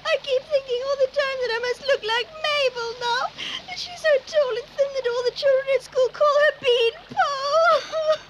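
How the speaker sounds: high, wailing sobbing voice; tearful crying. Acted. Girl who can't stop crying to her bunny, tearful wail. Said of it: "I can't stop crying".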